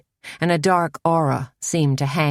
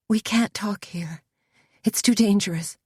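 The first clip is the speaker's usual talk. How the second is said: hushed urgent warning; frightened whisper; 5 semitones higher than usual. A narrator's reading. A scared girl warns Suzanne to leave, whispered. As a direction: whisper it, terrified of being heard.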